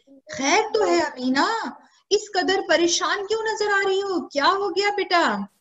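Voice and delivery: lightly acted worried elders, voice lifted; alarmed concern. Elders asking Amina why she looks so troubled, alarmed concern. Elders question a frightened child.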